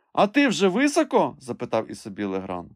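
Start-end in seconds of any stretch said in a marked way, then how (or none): 0.1-1.4 high, loud questioning call. A narrator's reading. Master asks climber how high he is, high loud call. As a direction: raise the voice to reach someone above.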